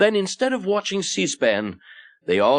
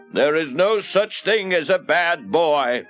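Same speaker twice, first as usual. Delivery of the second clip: slow, higher-pitched solemn quotation; slightly put-on, measured pronouncement; quoted priest's maxim; 5 semitones higher, slower than usual. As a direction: declare it slowly as a famous saying. Quoting Father Flanagan's famous saying.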